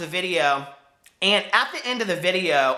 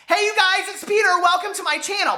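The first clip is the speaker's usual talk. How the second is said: squeaky intro voice; 12 semitones higher than usual. Retold or imitated. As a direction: sing out the hello way up high. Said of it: "squeaky and high-pitched".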